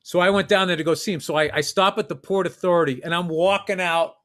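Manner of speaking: normal talking voice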